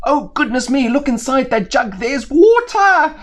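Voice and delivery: a bird that just found water; excited crow. Acted.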